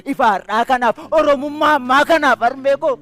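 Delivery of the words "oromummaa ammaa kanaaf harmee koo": ordinary delivery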